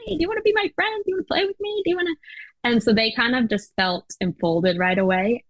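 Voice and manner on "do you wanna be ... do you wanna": put-on much higher, fast eager kid voice; imitating eager kids. Classmates welcoming her oldest, a high fast eager voice. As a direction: rattle it off like a keen eleven-year-old. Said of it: "it was like".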